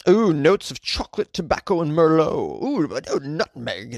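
mock-posh tasting notes; fancy. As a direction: savor each word like a snob. Mocking fancy coffee notes.

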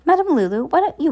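lightly put-on sweetness, high on the name; false sweet voice. A narrator's reading. Esme coaxes Madame Lulu onto the plank, falsely sweet. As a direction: coax with mock sweetness. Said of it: "with a false sweet voice".

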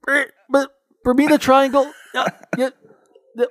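high, loud, stammering fake phone breakup; bad connection. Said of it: "trying to pretend like".

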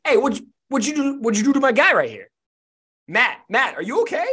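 high, loud, acted challenge; alarmed confrontation. Girl confronts a stranger over her guy, alarmed and demanding. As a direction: call out in character, pitch jumping up.

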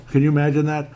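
mock-outraged repeat of the question; incredulous emphasis. Real, not acted. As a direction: push the question up in playful disbelief.

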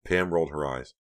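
plain reading voice

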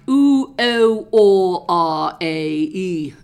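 loud, drawn-out voice-training vowels; vowel exercises. Shown as a demonstration. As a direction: belt out each vowel like a drama warm-up.

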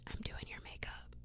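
unvoiced close whisper, very soft; ASMR whisper. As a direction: whisper as if doing their makeup. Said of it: "Y'all want some A S M R?".

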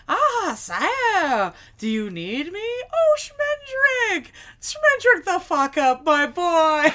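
high, loud, theatrical wizard; Mabrook. Acted. Wizard appearing and greeting Schmendrick, theatrical. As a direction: draw it out with gleeful flourish. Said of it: "appears out of thin air and is like".

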